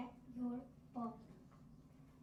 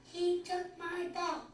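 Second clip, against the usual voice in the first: loud, high and slow outcry; big dramatic read; 6 semitones higher, 8 dB louder, slower than usual. Acted. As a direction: stretch it out like a big complaint. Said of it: "I love how you read that".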